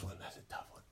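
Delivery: soft whispered admission. Real, not acted. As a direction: breathe it out almost to yourself. Hushed admission.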